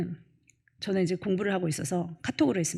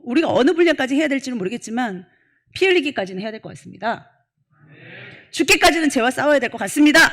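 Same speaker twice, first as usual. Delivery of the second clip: louder, higher sermon delivery; full-force preaching; 7 semitones higher, 9 dB louder than usual. Acted. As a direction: preach it hard to the congregation. Preaching to congregation.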